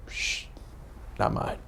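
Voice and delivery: softly shushing, nearly a whisper; hushed denial. Retold or imitated. To teammates in the huddle, a hushed denial. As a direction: hush them and deny it under your breath. Hiding that dad is his.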